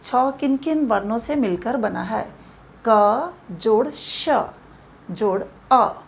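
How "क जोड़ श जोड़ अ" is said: क जोड़ श said slowly, each part apart; slow spelling out. Shown as a demonstration. Teacher slowly spelling out how छ is built. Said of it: "छ को देखिए".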